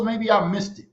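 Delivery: ordinary talk, nothing marked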